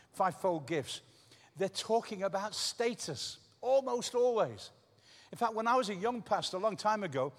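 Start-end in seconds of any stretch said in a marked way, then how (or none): 3.6-4.7 almost always drawn out slowly and pitched high; slow, high stress on almost always; emphatic, slow. Real, not acted. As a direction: lean hard into almost always. Emphatic point in preaching.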